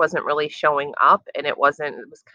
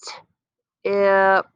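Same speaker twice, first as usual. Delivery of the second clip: slow blending sounds; slow sliding sounds; 4 semitones higher than usual. Shown as a demonstration. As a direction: slide through each sound, held long. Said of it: "slide to the sounds".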